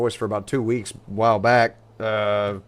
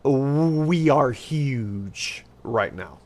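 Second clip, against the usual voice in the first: slow drawn boast; 2 semitones higher, much slower than usual. Real, not acted. Boasting about money.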